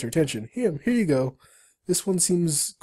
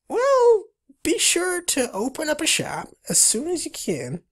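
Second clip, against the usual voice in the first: high, squeaky beaver voice; Billy; 8 semitones higher than usual. Acted. Beaver advising a newcomer on shops, high squeaky voice. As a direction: pipe up like a cartoon critter. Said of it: "says Billy".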